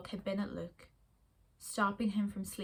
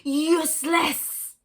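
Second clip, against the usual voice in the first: slow, loud, higher howl of a monster dog; howled word; 6 semitones higher, 9 dB louder, much slower than usual. Acted. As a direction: howl the word out, drawn out and huge. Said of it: "a howl that stayed and became a word".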